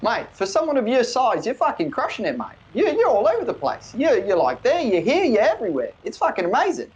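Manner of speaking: broad Australian, all mates; Australian accent. Acted. Sato greets the Draconic speaker in high Australian.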